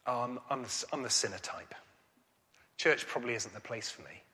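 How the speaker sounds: a touch of retold sadness, soft and low; sadly. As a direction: undertaker owning up to being a sinner, quietly sad. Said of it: "he said sort of sadly".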